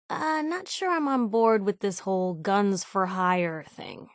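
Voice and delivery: usual speaking voice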